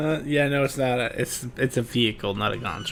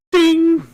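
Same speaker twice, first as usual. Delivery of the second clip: ding sound effect; 10 dB louder than usual. Acted.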